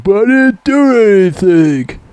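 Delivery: slow, high, whining plea; whiny Rambo voice. Retold or imitated. Rambo pleads innocence, slow high whine. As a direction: play it like a scared kid. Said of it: "He's like".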